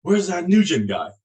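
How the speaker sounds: loud, high barked demand; drill instructor yell. Retold or imitated. Drill instructor yelling for a recruit on the bus, loud. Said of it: "he's yelling and screaming".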